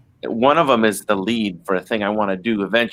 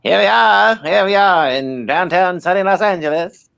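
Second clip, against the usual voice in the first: put-on high, loud old-time radio announcer; 1940s announcer; 9 semitones higher, 4 dB louder, slower than usual. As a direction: boom it out like a vintage newsreel host. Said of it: "your nineteen forties announcer".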